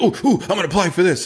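high, fast mock excitement; imitating eager son. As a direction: play the teen who wants to apply right now. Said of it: "And he's like".

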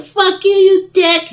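slow, loud, very high falsetto; upset fake girl. Sasha swears at caller before hanging up, upset fake girl voice. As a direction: curse indignantly in a put-on girl voice. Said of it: "she got so upset".

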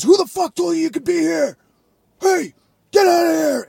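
high-pitched, strained mock yell; imitated angry shout. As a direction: act out an irate man chasing off a trespasser. Retelling angry man confronting him.

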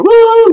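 high, loud whooping cheer; sudden high-pitched celebratory whoop; excited whoop. Real, not acted. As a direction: let out a big happy woo.

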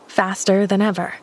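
ordinary delivery

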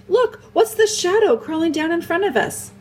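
high, excited questioning; great excitement. A narrator's reading. Edmund asking about a moving shadow, great excitement. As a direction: point it out urgently, pitch way up. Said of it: "he said in great excitement".

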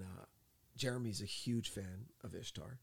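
ordinary talk

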